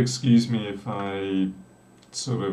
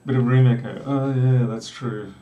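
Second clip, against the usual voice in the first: normal talking voice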